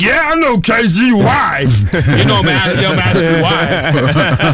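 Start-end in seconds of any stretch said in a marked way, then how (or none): 0.0-1.6 put-on high, slow drunken holler; drawn-out, high-pitched drunk bragging; drunken boast. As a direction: crow it like a happy drunk. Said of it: "'Cause I'm drunk".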